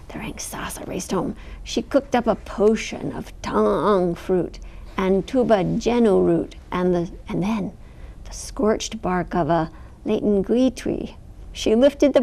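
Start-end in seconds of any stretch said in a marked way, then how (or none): none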